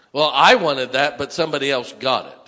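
loud sulky protest; mock sulky whine. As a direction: whine like a spoiled child. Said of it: "This is part of it".

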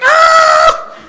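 very high, loud exasperated yell; frustrated shriek. Retold or imitated. Preacher acts his fed-up shriek at mom's endless lecture. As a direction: let out a kid's fed-up scream with a laugh. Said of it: "I'm just like".

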